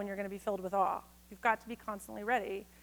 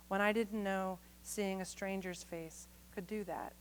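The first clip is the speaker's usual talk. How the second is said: soft, measured poetry reading; poem reading; 8 dB quieter, slower than usual. Acted. Reading a love poem to his wife, soft and measured. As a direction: read it quietly to your wife.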